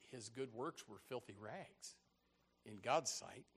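solemn hush. Real, not acted. Solemn preaching point.

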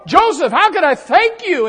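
high, loud, eager offer; rejoicing gratitude. Acted. Rejoicing butler thanking Joseph, excited. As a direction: gush with thanks to a friend. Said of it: "I imagine the butler just rejoicing".

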